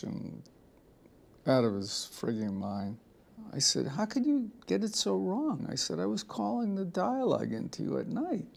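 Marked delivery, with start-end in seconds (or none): 3.9-8.5 higher-pitched incredulous reproach; mock disbelief at the botched script; retold exasperated confrontation.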